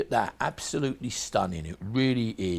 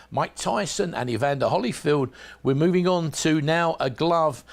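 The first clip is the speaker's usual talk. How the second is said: ordinary delivery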